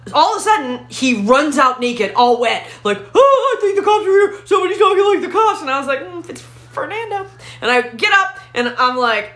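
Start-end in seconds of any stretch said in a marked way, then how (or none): 3.1-5.6 high-pitched, frantic shriek; hammed-up terrified wail; mock panic. Boyfriend runs out panicking about cops. 6.0-7.3 soft, high little murmur; small, hushed re-enacted answer; understated reply. Deadpan answer to boyfriend's panic.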